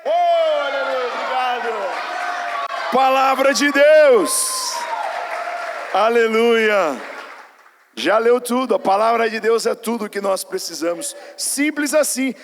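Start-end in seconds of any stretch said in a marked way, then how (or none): 0.0-7.0 slow, loud, ringing praise; loud praise shout. Real, not acted. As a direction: shout the hallelujah to the room. Praising after the Bible reading.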